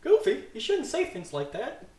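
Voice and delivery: high, slow little cricket scolding; Jiminy Cricket voice. Acted. Jiminy scolding Goofy, high and squeaky. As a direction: chide him in a squeaky cricket voice.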